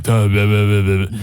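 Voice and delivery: low, repeated stuttering syllables; imitated lost-voice babble. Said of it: "I was like".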